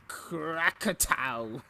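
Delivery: slow, stretched-out comic sound effect; drawn-out sound effect. Acted. Reading the comic's crash sound, slow and drawn out. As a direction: boom it out slowly like a comic-book blast.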